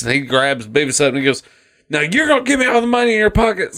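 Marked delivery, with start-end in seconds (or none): 1.9-3.8 higher, fast cartoon menace; mock threatening waiter. Angry waiter shakes Beavis down for money, in a high, fast mock menace. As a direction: rattle off the demand in a squeaky villain voice.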